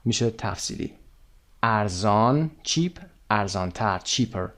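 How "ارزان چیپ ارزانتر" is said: ordinary delivery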